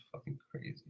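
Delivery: a breathy under-the-breath whisper; whispered aside. Real, not acted. Streamer mutters to himself about the scripture, whispered. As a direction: drop to a whisper, half to yourself.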